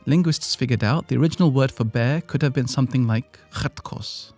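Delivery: ordinary talk, nothing marked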